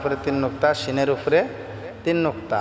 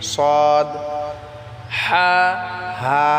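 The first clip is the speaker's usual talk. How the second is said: letter names chanted on a held, level pitch; drawn-out teaching recital; letter recitation; much slower than usual. Shown as a demonstration. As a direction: recite each letter long and even, one at a time.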